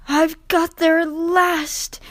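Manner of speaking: high, slow, relieved breath; relieved sigh. A narrator's reading. Rheneas arriving exhausted, sighing with relief. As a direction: let it out with relief. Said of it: "he sighed with relief".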